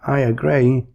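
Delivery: a touch of glee; brightened gleeful reply. A narrator's reading. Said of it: "gleefully said".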